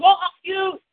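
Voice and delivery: high, dismissive parent voice; mock parent. Parents telling the shy child to do it, mock high. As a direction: toss it back at the kid. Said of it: "our parents said".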